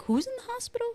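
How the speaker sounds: high-pitched puzzled question; baffled. Retold or imitated. Niece questioning her aunt's phone news, baffled. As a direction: ask in a high, bewildered voice.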